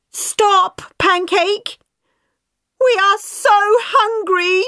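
put-on very high, pleading kids' voices; hungry children. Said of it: "they said".

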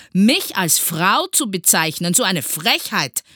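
outraged teacher, loud and high; indignant scolding. A narrator's reading. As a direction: scold with offended dignity. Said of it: "schimpfte Herr Groll". (empört und laut schimpfend)

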